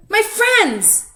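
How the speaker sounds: very high, loud joyful cry; excited cry. A narrator's reading. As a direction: burst out with hope. Stanley realizes friends passed.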